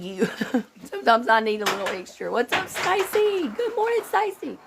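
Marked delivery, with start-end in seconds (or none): 2.3-4.6 high sing-song greeting. Real, not acted.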